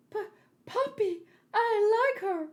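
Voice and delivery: put-on a spoiled boy begging his papa, high; childish prince voice. A spoiled prince pleading with his papa, high and whiny.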